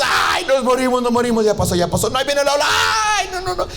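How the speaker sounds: high, rising panic ending in a whimpering cry; panicked shrieking. Acted. Disciples panicking in the storm, shrieking high. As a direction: shriek like first time on a roller coaster. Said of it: "estaban así chillando".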